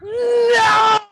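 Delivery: sharp, high, loud shout of no. Retold or imitated. Boss horrified at ice cube in shot glass, shouting. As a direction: yell it out in horror.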